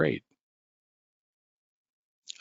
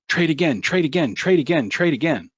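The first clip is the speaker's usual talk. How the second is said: higher, insistent demon chanting in your head; bombastic inner demon; 5 semitones higher, faster than usual. Acted. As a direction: nag like a frantic demon on a loop. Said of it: "that demon who's bombastically flailing in your mental background".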